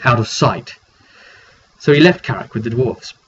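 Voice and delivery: ordinary talk, nothing marked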